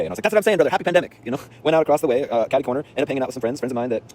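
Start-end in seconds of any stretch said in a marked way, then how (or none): 0.2-1.2 high, loud triumphant agreement; jubilant. Retold or imitated. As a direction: cheer it after a departing friend. Acting out his jubilant parting call.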